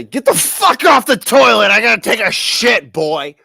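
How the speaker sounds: loud, high angry shout; dad yelling. Retold or imitated. Father yelling at his son to get off the toilet, loud and angry. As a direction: bark him off the toilet.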